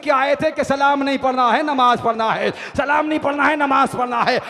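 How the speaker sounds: loud, high-energy sermon delivery; impassioned rhythmic declamation; full-force preaching. A narrator's reading. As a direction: hammer each phrase out to a large crowd.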